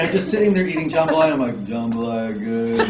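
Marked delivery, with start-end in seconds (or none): 1.7-2.8 put-on slow, lower drunken contentment; drunk simpleton. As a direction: savor it slowly like a happy drunk. Drunk self eating, acted out.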